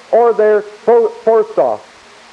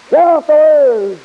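forceful shout; 6 semitones higher, 3 dB louder than usual. Acted. Satan forcing off the Word.

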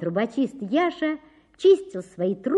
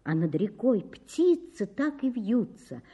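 ordinary delivery

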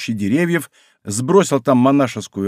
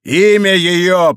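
loud, high, drawn-out demand; требование; 6 semitones higher, 6 dB louder, much slower than usual. A narrator's reading. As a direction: wrench the name out of him. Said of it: "как будто желая вырвать из него". (яростно требуя)

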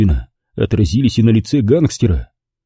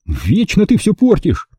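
high-pitched offended reproach; hurt, offended; 9 semitones higher than usual. A narrator's reading. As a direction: complain like someone wronged. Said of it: "обиделся Джерри".

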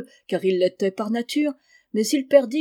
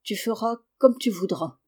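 usual speaking voice

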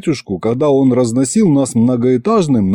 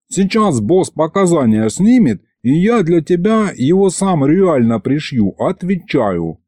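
high-pitched thug's promise; cocky gangster demon voice; 6 semitones higher than usual. Acted. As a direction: vow it like a street tough. Thuggish demon vows to kill.

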